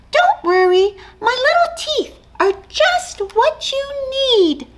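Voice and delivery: eager tiny mouse; brave little mouse. Acted. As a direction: puff up small and bold. Mouse voiced in story.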